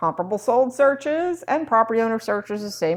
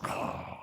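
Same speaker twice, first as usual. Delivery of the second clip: acted-out sigh of dismay; exasperated sigh; 16 dB quieter than usual. Agents hearing a customer wants a fizbo, mock exasperated sigh. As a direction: sigh as every agent would. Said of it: "same reaction all of us have".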